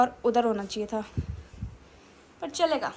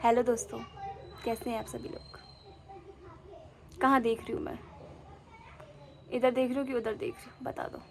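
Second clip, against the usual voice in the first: a softer, hushed goofy act; 2 semitones lower, 11 dB quieter than usual. Pretend. Showing viewers her goofy crooked act, softly. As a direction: play it weird and hushed, teasing. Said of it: "मैं आप लोगों को टेढ़ी बन के दिखाऊँ".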